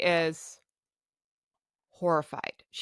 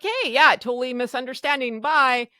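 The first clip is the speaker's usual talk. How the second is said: mock cheery staff; 5 semitones higher, 5 dB louder than usual. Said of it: "they're gonna be like".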